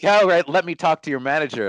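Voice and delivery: hammed-up angry customer insisting; demanding the manager. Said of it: "And then he goes".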